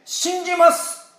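high, slow, firm I believe; firm declaration. Retold or imitated. Preacher voicing what Mary did not say, high and firm. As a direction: declare it with conviction. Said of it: "と言わなかったんですね".

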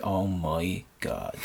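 very slow, low and soft groan; defeated low moan; despair. Retold or imitated. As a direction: let it sink out of you. Despair as tow refused.

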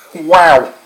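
laughing through chili burn; talking with a burning tongue; mouth burning from spicy food. Real, not acted. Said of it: "my mouth is on fire".

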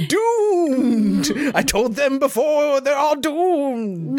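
high, wailing prophet of doom; doomsayer shout. Retold or imitated. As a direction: cry doom at everyone. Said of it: "The Harbinger's back".

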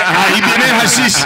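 loud, drawn-out imitation of her; hammed-up lookout's cry; mock announcing call. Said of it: "Ella dice".

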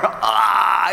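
high, drawn-out "ah"; mock yowl at a hammered thumb; mock cry of pain. Preacher acting out a hit thumb, pained cry.

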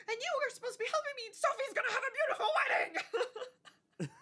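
high, fast, laughing mock shout; mom's rant. Imitating Donna scolding the boys, high fast laughing shout. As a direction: scold the stumbling boys in a squealed rush. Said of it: "she's like".

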